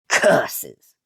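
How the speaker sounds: loud hammed-up villain curse; villain's cry. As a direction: spit out curses like a stage villain. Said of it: "he cried".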